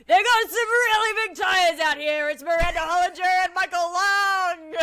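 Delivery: loud hyped ad announcer; monster truck ad. Acted. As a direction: pump up the crowd for the trucks. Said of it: "reading this as a monster truck advertisement".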